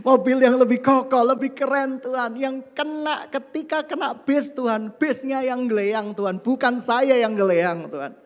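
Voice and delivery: loud, high pleading to God; pleading prayer. Retold or imitated. Preacher acting out his demand to God for a sturdier car, loud and high. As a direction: press the request hard on God.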